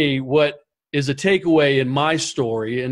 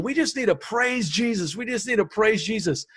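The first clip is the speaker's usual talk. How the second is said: higher, softer, hushed plea to praise; hushed worship leader; 6 semitones higher, 5 dB quieter than usual. Retold or imitated. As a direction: imitate the worship leader gently urging the crowd. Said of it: "he's just going".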